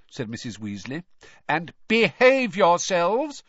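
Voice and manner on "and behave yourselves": slow, loud, high warning; calling after. A narrator's reading. Mother warning the departing twins, calling out. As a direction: call it out firmly to their backs. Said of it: "she called after the twins' retreating backs".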